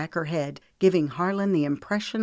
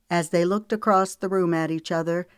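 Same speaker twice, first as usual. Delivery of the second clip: usual speaking voice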